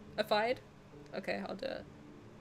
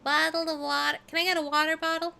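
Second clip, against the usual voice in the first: put-on American accent, lifted in pitch; casual American-accented request; 7 semitones higher than usual. Shown as a demonstration. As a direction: say it quickly like an American. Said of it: "say it a little bit American".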